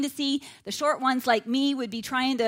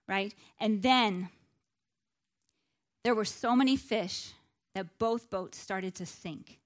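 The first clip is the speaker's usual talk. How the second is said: ordinary delivery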